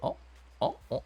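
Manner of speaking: ordinary talk, nothing marked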